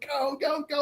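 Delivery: light imitation of excited yelling; retold yelling. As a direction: urge a runner on, scaled-down yell. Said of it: "I was yelling".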